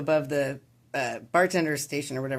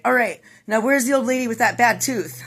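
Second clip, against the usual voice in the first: higher and louder, triumphant; punchline exclamation; 6 semitones higher, 6 dB louder than usual. Retold or imitated. Bloodied guy to the bartender, loud and triumphant. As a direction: bursts back in proud and confused.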